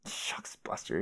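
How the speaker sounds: soft, breathless, fading away; whispered catchphrase. Acted. Jake muttering his catchphrase to Jane, soft whisper. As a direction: whisper it sheepishly.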